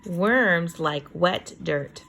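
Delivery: usual speaking voice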